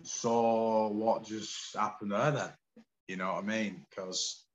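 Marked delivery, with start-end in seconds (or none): 0.0-2.5 slow, stunned musing; slow, bewildered self-question; dazed wonder. Retold or imitated. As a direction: ask it slowly, still reeling. Quoting his own dazed thought.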